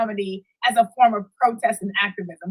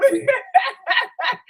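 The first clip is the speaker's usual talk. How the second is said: high bright laugh; 12 semitones higher than usual. Real, not acted. Guest laughs at the show.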